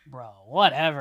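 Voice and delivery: a loud, dismissive brush-off; mock dismissive. Brushing off music snobs with a loud dismissive scoff. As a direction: wave them off with a scoff.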